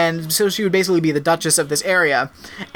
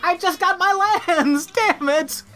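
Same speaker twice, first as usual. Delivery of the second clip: whiny, higher pitched complaint; high whiny wife voice; 12 semitones higher, slower than usual. Retold or imitated. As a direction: whine like a cheated duchess. Voicing the wife, far voice.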